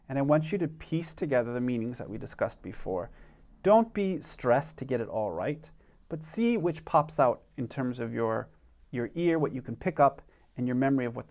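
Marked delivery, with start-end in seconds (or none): none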